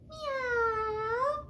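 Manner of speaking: long, high meow; cat's meow. A narrator's reading. Puppy George answers the doctor with a high cat's meow. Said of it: "And George said".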